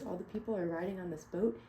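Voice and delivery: plain reading voice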